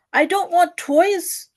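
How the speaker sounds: put-on high, very slow hungry child; hungry baby refusing the toys, high and slow; child's voice. A narrator's reading. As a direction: draw it out like a fed-up little child.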